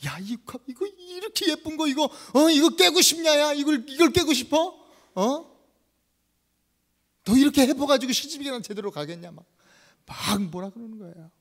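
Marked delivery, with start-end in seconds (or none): 0.1-9.3 high, loud acted scolding; yelling disciple. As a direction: rail at her, pitch climbing sharply. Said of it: "그러면서 막 소리를 질러요". (소리 지르며 야단치는 제자)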